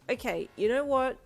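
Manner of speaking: tired. Acted. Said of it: "in a suddenly very tired voice".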